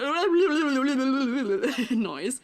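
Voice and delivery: burbling drowning sound; comic gurgle of drowning; drowning noise. Shown as a demonstration. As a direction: burble rapidly as if going under. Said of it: "E G Drowning. I did do a".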